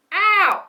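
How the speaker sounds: sudden high loud yelp of pain; sharp ow. Real, not acted. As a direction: yelp as the brush snags. Hurts herself brushing hair.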